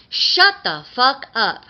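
loud, slow furious shout; Mister Kink's bellow. A narrator's reading. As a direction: cut them off with a drawn-out bellow. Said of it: "Mister Kink bellowed".

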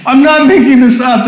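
normal talking voice

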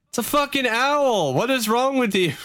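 high-pitched, incredulous scolding; exasperated bystander. Acted. Bystander scolding screamers over an owl, exasperated.

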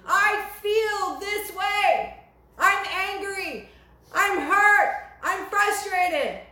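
high, loud, slowed desperate cry; crying out to Jesus. Retold or imitated. Believer screaming at Jesus in grief, high loud cry. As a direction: pour out hurt and anger upward. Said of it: "crying out and screaming at him".

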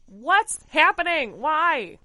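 loud, slow shocked cry; appalled disbelief. Real, not acted. Appalled reaction to the scene.

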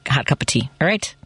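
usual speaking voice

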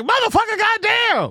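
very high, hammed-up cursing; Eddie Griffin. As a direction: exclaim in a high comic impression. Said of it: "Eddie Griffin, he's like".

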